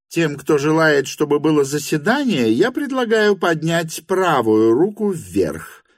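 high, declaring hostess voice; hostess announcing. A narrator's reading. Madam calls for a show of hands, high announcing voice. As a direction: announce the rule to the room, high-pitched.

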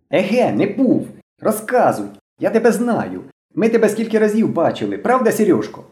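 put-on high-pitched, scornful boy; excited accusing boy. A narrator's reading. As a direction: challenge his denial in a high voice. Blond boy keeps accusing Slavik.

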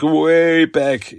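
"way back" held long for emphasis; drawn-out emphasis. Real, not acted. Recapper dating an old issue, drawn-out emphasis on "way back". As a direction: stretch out "way back" slowly. Stressing how long ago it was.

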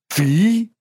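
high-pitched astonished question; squeezed-out disbelief. A narrator's reading. Masha hears he can help her sister, strangled disbelief. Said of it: "выдавила Маша".

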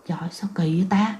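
hushed, breathy whisper to herself; frightened whisper to herself. Retold or imitated. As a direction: whisper it, scared to be heard. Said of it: "suy nghĩ một hồi cái nói".

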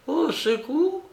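au secours in a mock hoarse, high voice; mock hoarse cry. Aunt mimicking her nephew's hoarse cry. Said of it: "d'une voix si enrouée".

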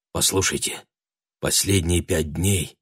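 soft, low urgent voice; hushed. A narrator's reading. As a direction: keep it down, urgent. Shandy urges Beth, hushed.

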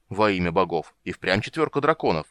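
usual speaking voice

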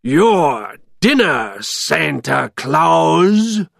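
loud, drawn-out monster taunt; big storybook monster voice; menacing villain. A narrator's reading. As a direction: gloat slowly over your captive. Villain taunts captive Santa.

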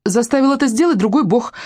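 put-on high-pitched girl's blurt; sudden squeak. A narrator's reading. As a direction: squeak it out like a scared little girl. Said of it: "внезапно пискнула Челли".

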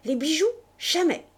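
cri aigu et fort de refus; cri désespéré. Acted. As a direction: crier non pour protéger les bijoux. La comtesse refuse en criant. (la comtesse crie son refus à Bush, paniquée)

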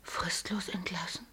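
whispered, disbelieving question; shocked whisper. Acted. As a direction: breathe it out in disbelief. Ehefrau schockiert über Entlassung. (geflüstert und fassungslos)

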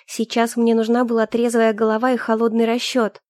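ordinary delivery, nothing marked

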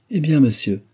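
high, bright call to the doctor; lively exclamation. A narrator's reading. Milady hailing the doctor as he arrives, in a lively cry. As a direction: hail him with teasing cheer. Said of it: "s'écria milady Pemberton".

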